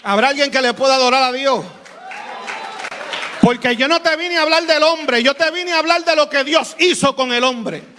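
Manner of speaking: high, slow and shouted, like a revival preacher; preacher retelling a miracle to his church, slow fervent shouting; preaching at full force. Acted. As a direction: proclaim God's message to the congregation. Preaching the stranger's message.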